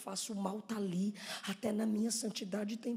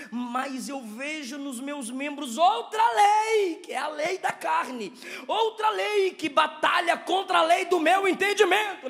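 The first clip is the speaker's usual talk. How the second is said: loud, raised, drawn-out declamation; fervent preaching; 9 semitones higher, 16 dB louder, slower than usual. Real, not acted. Preacher declaiming Paul's inner battle to the church, rising fervour. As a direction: build to a shouted scripture line. Preaches a verse at full force.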